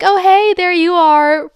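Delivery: high, loud singsong hello; put-on flirty greeting. Greeting the other guy beside him, put-on flirty. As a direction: lift the voice into a showy greeting.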